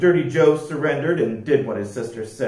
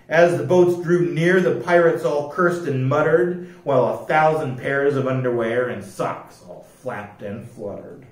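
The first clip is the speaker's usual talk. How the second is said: ordinary delivery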